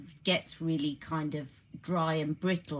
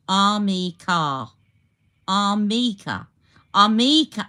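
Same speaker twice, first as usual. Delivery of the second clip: Armica, slow and loud, falling tune; repeating Armica slowly to learn it; practising the word; 3 semitones higher, 11 dB louder, slower than usual. Shown as a demonstration. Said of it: "the emphasis on the me".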